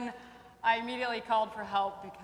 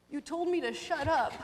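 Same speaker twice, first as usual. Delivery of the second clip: lightly voiced hurt kid, higher pitch; a hint of a wounded little pupil; crying child imitation; 6 semitones higher than usual. Crying pupil accusing his teacher, mildly imitated. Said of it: "as Zaire's crying".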